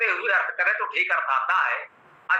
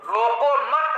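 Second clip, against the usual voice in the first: रोको मत run together, higher, rising on मत before a break; pause after मत; 5 semitones higher, slower than usual. Shown as a demonstration. Teacher moving the comma, pause after मत. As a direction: lift रोको मत as one unit, then stop. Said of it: "मत के पश्चात लगा दिया".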